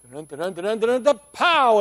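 hummed dun-dun notes climbing to a loud high pow; mock drumroll that peaks on pow; dramatic build-up sting. Shown as a demonstration. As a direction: build the suspense, then hit pow. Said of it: "and we have the great ending".

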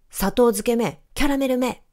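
mild shouted insult; angry yell. A narrator's reading. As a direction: snap out the insults. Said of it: "と怒鳴りました".

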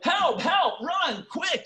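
high, panicked shouts of help and run; urgent cry to flee the fire; frantic shouting for help. Retold or imitated. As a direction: yell it like someone trapped in a burning building. Someone about to burn up.

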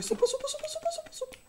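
very high, thrilled repeated squeal; excited squeal. Real, not acted. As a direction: cheer at finding the soup. Found the item.

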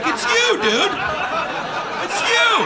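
high, loud mock accusation; accusing heckler. Audience member calling out the ventriloquist, in a high loud voice. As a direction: point out the ventriloquist. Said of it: "It's like".

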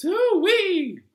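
squealed very high, loud hoo-wee; a gleeful falling whoop. Acted. Wiggling hips in the chant, squealed whoop.

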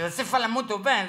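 Brazilian pastor. Retold or imitated. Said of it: "me dice".

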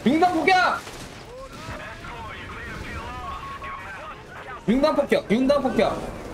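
high, repeated battle cry; battle roar. Acted. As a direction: scream the bombing call, then ease off. Repeated bombing call.

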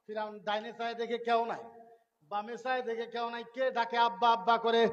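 ordinary talk, nothing marked

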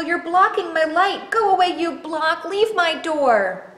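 high, loud, hurried protest; frightened Triangle. Acted. Triangle to Square stuck in the door, panicked. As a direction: panic and order them away. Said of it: "Triangle was afraid of the dark".

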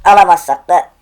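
loud, bright half-laughing shrug. Real, not acted. Streamer shrugging off a possible loss, loud. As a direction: toss it off loudly.

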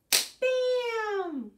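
very high, loud bam; shrieked sound-effect bam; shouted bam. Acted. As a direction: burst out like a popping pan. Rhyme punchline sound.

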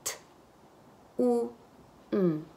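"t, u, n" as isolated sounds with long gaps. Shown as a demonstration. Teacher asks for the phonemes, sound by sound. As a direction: sound out "t, u, n" one at a time. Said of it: "Y las fonemas".

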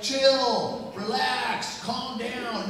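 high, exasperated mock husband; dismissive husband. Husband telling agitated wife to relax, dismissive.

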